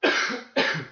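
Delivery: coughing fit. Real, not acted. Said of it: "Sorry, I keep coughing".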